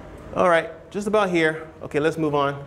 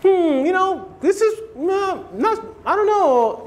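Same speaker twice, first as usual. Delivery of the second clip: higher, slow, hesitant hedging voice; mock vague critic, slow and much higher; indirect hedging; 9 semitones higher, slower than usual. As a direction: hum and hedge, pitched well up and drawn out. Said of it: "By doing that indirect stuff".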